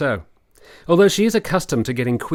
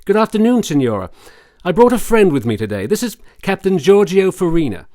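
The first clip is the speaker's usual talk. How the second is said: louder, higher, cheery bedside hello; bright greeting; 5 semitones higher, 6 dB louder than usual. Acted. Doctor cheerfully greets the sick signora, louder and higher. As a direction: announce the visitor with forced cheer.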